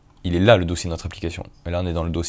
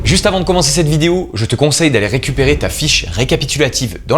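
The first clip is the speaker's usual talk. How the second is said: louder, higher, energetic call to action; promo plug; 6 semitones higher, 7 dB louder than usual. Acted. Tutorial host urging viewers to grab the free sheet, promo plug. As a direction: push the free resource with punchy emphasis.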